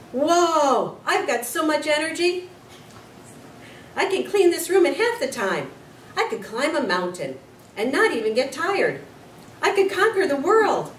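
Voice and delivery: loud, high exuberant boast; a lovestruck man overflowing with energy; bursting with energy. Acted. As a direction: sound like you could run forever.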